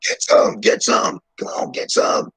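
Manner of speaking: loud, pushed-out taunting chant; mock shooter taunt. Playing an imagined gunman taunting victims, loud and hammed up. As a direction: egg on an enemy, hammed up.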